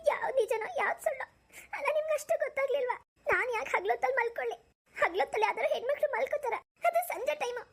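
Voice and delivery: put-on high, tearful young wife's voice, breaking into sobs; a hurt bride crying and accusing her husband; wife crying over the husband she thinks betrayed her; tearful new bride.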